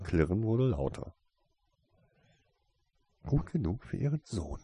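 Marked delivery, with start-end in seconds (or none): 3.3-4.6 higher-pitched, restrained snarl; stung, hissing retort; angry snarl. A narrator's reading. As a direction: snap back angrily. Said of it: "fauchte er".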